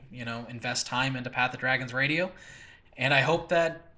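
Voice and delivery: normal talking voice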